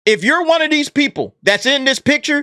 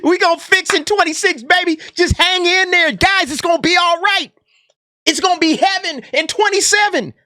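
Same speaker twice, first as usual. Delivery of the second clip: mock reassurance; 8 semitones higher than usual. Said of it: "mocking hollow promises to workers".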